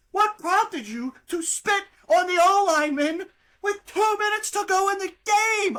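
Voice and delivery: high, loud outraged yelling; exasperated shout. Real, not acted. Podcaster raging at the lineman who spat, shouting. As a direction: yell the question in disbelief. Ranting at the player's spit.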